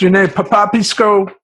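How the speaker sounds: Papapisco called high and loud; guard mangling the name Papapisco; mangled surname. Retold or imitated. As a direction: mispronounce Papapisco with confidence. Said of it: "he couldn't say the last name Procopio".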